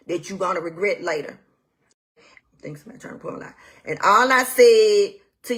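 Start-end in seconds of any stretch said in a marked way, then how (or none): 2.2-3.5 fast, soft whispered aside; a quick sneaky whisper to the side. Real, not acted. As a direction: whisper it under your breath, quickly. Muttered aside during the rant.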